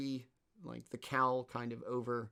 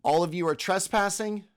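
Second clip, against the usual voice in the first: stern decree, slowing toward the end; commanding voice; 4 dB louder than usual. Acted. Hooded thief orders trespassers out under threat of death, commanding. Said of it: "in a commanding voice".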